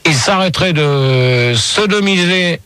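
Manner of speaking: normal talking voice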